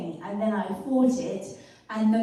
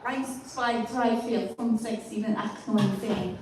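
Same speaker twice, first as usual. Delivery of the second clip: counting to ten in a German accent. Shown as a demonstration. A comedian showing her German accent on request, in a light German accent. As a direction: rattle off the German numbers like a tourist. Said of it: "Oh, German".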